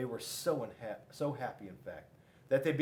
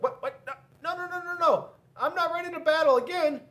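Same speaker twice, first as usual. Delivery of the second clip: much higher, fast panicky refusal; squeaky panicked Paper; 8 dB louder, faster than usual. Acted. Paper refuses Scissors' challenge, high panicky voice. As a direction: squeal and back off.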